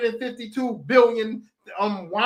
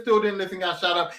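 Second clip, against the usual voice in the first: ordinary delivery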